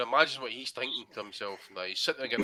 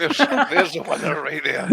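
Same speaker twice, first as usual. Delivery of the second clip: slow, louder words through laughter; laughing; 4 semitones higher, 8 dB louder, slower than usual. Real, not acted. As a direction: laugh as you crown the winner. Hearing a funny nomination.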